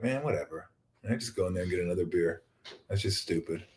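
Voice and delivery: shrugging it off. Retold or imitated. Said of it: "he kinda shrugs".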